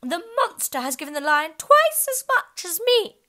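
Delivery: loud, high complaint; loud, high indignant unicorn; indignant cry. Acted. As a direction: protest loudly as unfair. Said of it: "cried the unicorn".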